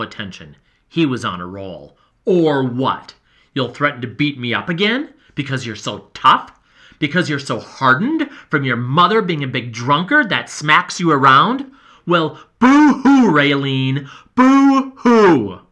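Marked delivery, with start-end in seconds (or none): 2.2-11.7 loud, sneering jabs; cruel taunt. Acted. Boy taunting the girl, loud and sneering. 12.1-15.6 loud, high mock boo-hoo; mock crying taunt. Boy mocking the girl with fake sobbing.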